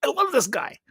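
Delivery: high-pitched retold fan delight. Retelling his delight at recognizing actor.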